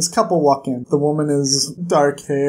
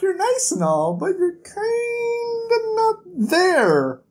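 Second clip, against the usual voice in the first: mock weepy [name]; 13 semitones higher, slower than usual. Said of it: "it's like".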